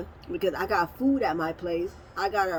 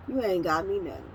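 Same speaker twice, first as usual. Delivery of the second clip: ordinary talk, nothing marked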